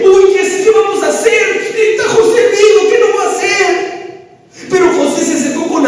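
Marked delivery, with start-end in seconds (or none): 0.0-4.3 anxious panic. Acted. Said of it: "Había ansiedad en sus corazones".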